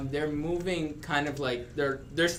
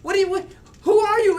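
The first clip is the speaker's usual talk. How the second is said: put-on high, loud frightened child shriek; a scared kid shrieking at strangers; panicked kid; 9 dB louder than usual. Startled child shrieks at the intruders, high and loud.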